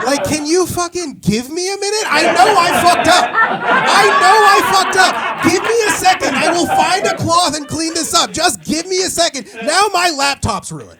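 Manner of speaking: high, loud, frantic begging; frantic pleading. Acted. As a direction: beg the spill to wait, yelling. Pleading with spilled water.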